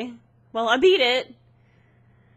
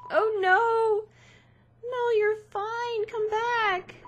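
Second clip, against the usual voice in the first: pleading reassurance; 12 semitones higher, 3 dB louder, slower than usual. Real, not acted.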